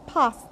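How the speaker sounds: passed said as past, light final t; t ending. Shown as a demonstration. Teacher modelling past, final t left unstressed. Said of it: "we don't put stress on ta".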